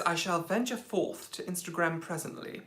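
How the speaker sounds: mock-grand posh accent, softly delivered; lightly put-on posh voice. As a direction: read it like a posh aristocrat. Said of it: "Very posh".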